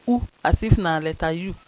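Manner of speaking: usual speaking voice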